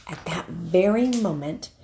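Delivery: ordinary delivery, nothing marked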